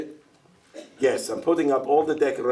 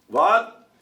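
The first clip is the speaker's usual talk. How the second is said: louder, higher what into the phone; louder repeat; 7 semitones higher, 4 dB louder than usual. Acted. Tevye repeating louder to the operator on the phone. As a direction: shout it again down the line.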